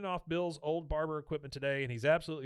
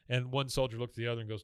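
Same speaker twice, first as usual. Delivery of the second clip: ordinary talk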